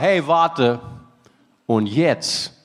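low, halting and heartfelt; earnest request. Retold or imitated. Klaus takes the pastor's hand, asking earnestly and low. Touching punchline spoken as Klaus.

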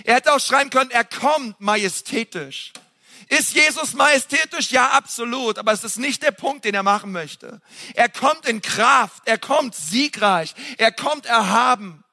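high, intense sermon voice building up; fervent preaching. Acted. Pastor to congregation on Jesus the King, fervent preaching. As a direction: preach it with rising fire.

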